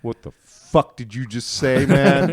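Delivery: high, slow, loud offended challenge; offended vendor. Retold or imitated. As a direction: demand it, taken aback. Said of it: "And he goes".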